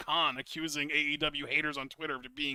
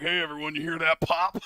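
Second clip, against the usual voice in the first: cocky boast; 6 dB louder, faster than usual. Retold or imitated. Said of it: "he's like".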